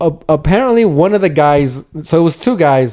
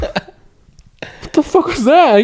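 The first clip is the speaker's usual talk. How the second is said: much higher, very slow disbelief; mock incredulous; 12 semitones higher, much slower than usual. Friend reacting to the mechanic's outburst, slow high disbelief. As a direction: stretch out your disbelief.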